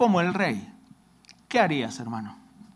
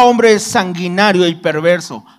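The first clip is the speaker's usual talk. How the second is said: scripture read aloud, a little louder; lectura bíblica; 5 dB louder than usual. Acted. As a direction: read the verse out to the congregation. (leyendo la Biblia en voz alta)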